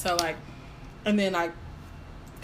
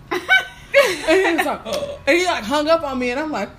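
laughing; 8 semitones higher, 7 dB louder than usual. Real, not acted. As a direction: laugh and gasp through. Laughing while telling shock.

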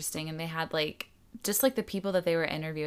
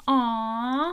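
cooing aww; 7 semitones higher, 5 dB louder than usual. Real, not acted. Cooing at cute kids in the ad.

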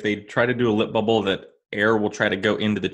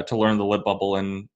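ordinary talk, nothing marked

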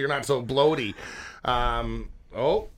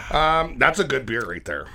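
ordinary delivery

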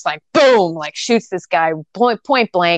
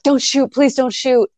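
higher-pitched pleading, imitating crying; mock tearful plea; 7 semitones higher than usual. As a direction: plead like a frightened character, lightly acted. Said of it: "like, crying".

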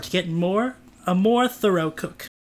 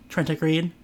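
plain reading voice

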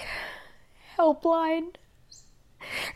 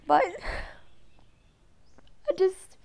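starting to cry; 8 semitones higher, 5 dB quieter than usual. Real, not acted. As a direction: voice breaking into tears, much higher. Breaking down over her mother.

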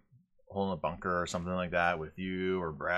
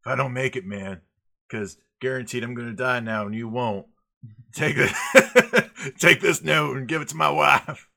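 louder, higher mock last words, laughing; hammed-up dying soldier note; 5 semitones higher, 9 dB louder than usual. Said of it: "be like".

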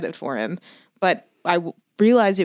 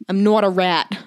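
put-on Boston accent from a crime film; Boston movie accent; 4 dB louder than usual. Quoting The Departed to co-host, put-on Boston accent. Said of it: "That's what they say in The Departed".

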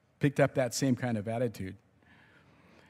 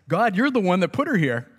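higher, louder, pointing the finger at God; Adam accusing God, higher and louder; 7 semitones higher, 6 dB louder than usual. Retold or imitated. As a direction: throw the blame right back at him. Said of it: "he's blaming God because he says".

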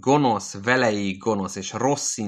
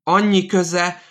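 much higher, louder shout; shouted repeat; 10 semitones higher, 7 dB louder, slower than usual. Acted. Old man in hospital bed shouting at the nurse, shrill yell. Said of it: "ismétli".

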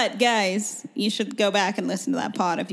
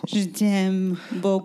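French chef; much slower than usual. Pretend. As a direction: sigh it like a French lover. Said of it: "this episode's accent".

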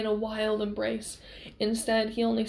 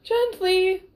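loud, high-pitched repeat; not so gently; 10 semitones higher, 8 dB louder than usual. A narrator's reading. Lover repeats the word, not so gently, loudly. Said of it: "not so gently this time".

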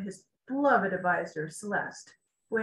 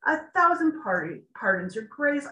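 apologetic; 5 semitones higher, 4 dB louder than usual. A narrator's reading. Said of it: "Tiberius said with an apologetic tone".

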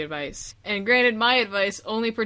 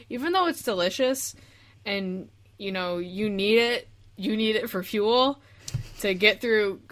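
usual speaking voice

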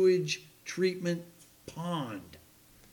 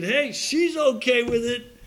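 pleased thought; 6 semitones higher, 6 dB louder than usual. Retold or imitated. Retold inner thought.

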